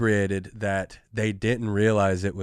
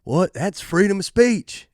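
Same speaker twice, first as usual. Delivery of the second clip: put-on higher, louder outraged drunk; indignant drunk; 9 semitones higher, 4 dB louder than usual. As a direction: bray it like a drunk sure of his rights. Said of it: "his partner was like".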